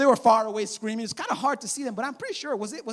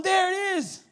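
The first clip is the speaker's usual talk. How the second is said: higher, delighted announcement; triumphant reveal; 7 semitones higher than usual. Retold or imitated. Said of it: "And Jesus like".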